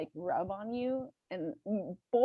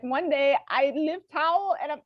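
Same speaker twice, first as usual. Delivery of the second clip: imitating housekeeper's English; 6 semitones higher, 4 dB louder than usual. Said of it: "her English is not the best".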